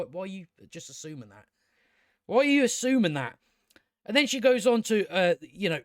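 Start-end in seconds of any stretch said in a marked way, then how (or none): 0.0-1.5 quick, much softer, half to yourself; muttered question. Real, not acted. Reviewer mutters at the character, soft and quick. 2.3-3.4 exasperated demand. Real, not acted. Questioning the character, repeated.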